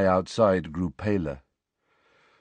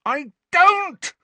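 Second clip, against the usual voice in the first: much higher, much louder, throat-tearing scream; 7 dB louder than usual. A narrator's reading. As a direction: scream it so loud it hurts. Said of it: "Harry screamed".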